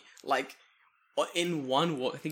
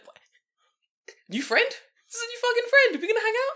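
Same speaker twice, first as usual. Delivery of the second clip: very high, loud thrilled dog; excited dog voice; 9 dB louder than usual. Retold or imitated. Voicing the dog meeting a new friend, very high and loud. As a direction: squeal with joy like a puppy. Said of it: "he's like".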